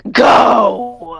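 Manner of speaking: hissed, growled command; hissing growl. Acted. Tiny dragon growls at the lizard to go away. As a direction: spit the word out like a hiss. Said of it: "with a hissing tone, sort of growls at him and goes".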